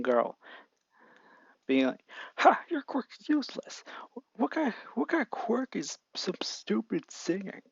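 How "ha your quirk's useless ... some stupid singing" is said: high, sneering schoolboy taunt, slowed; mocking bully voice. Bully Bakugo sneers at a young girl, high mocking voice. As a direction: jeer at her quirk from up high. Bully mocks girl's quirk.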